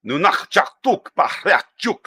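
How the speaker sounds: slow, guttural made-up Klingon phrase; playful Klingon show-off; mock Klingon. Shown as a demonstration. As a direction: bark it out like a Klingon warrior. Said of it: "I can speak Klingon".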